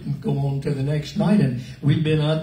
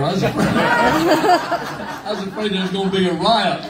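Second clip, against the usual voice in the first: laughing while telling it, higher and louder; 5 semitones higher, 4 dB louder than usual. Real, not acted. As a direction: laugh through the memory of the fear. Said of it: "I was too scared to stay there".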